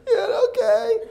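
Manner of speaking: high, loud tearful whine; hammed-up sobbing child's reply; obey through mock tears; crying boy. Crying boy obeys the coach.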